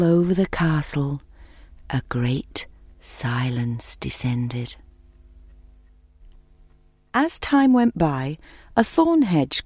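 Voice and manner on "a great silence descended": slow, soft whispered narration; whispering. A narrator's reading. Silence falls over castle.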